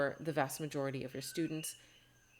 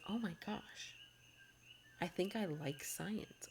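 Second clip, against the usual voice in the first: hushed awe; 3 semitones higher, 8 dB quieter than usual. Retold or imitated. Student quietly discovering they like science.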